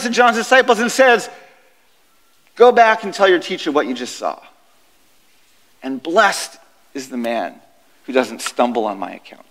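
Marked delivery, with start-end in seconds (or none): 2.6-9.5 calm, solemn reply. Acted. Jesus answering John's disciples, low and calm. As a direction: let the blessing sink low and gentle.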